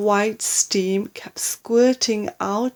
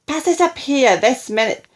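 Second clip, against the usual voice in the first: loud, higher, bossy demand; shouting; 4 semitones higher, 5 dB louder than usual. A narrator's reading. Grandma demanding the cup from boy, loud bossy order. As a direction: order it sharply as the old hag. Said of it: "the enormous old hag shouted".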